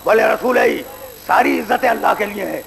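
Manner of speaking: usual speaking voice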